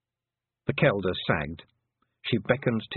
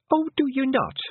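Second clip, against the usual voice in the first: higher, pointed retort; sharp; 4 dB louder than usual. A narrator's reading. Fionn snaps back at Tiffany, sharp and higher. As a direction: cut her down with a sharp question. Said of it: "said Fionn sharply".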